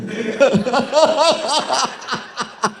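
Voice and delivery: high burst of laughter at his own joke; preacher cracking up at his own joke; hearty laugh. Real, not acted.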